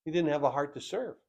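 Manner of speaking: a soft, quick aside to the congregation; hushed. Acted. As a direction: drop the voice to almost nothing. Repeated line, first said hushed.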